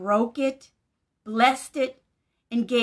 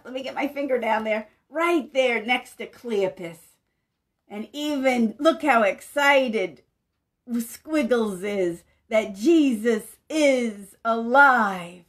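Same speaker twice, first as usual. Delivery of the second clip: ordinary talk, nothing marked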